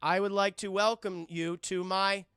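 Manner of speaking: slow, loud formal announcement; mock press statement. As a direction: address the press grandly. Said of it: "and he's like".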